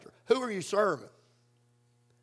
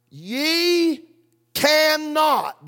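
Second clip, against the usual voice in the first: "Ye cannot" raised and pressed hard; emphatic; 5 semitones higher, much slower than usual. Shown as a demonstration. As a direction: hit "Ye cannot" as a firm verdict. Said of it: "how emphatic, dogmatic Jesus puts it".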